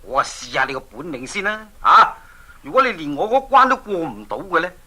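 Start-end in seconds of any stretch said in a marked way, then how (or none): 1.8-2.1 punchy loud "啊"; loud exclamation. Acted. King daring the young hero, loud. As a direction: bark it out as a dare.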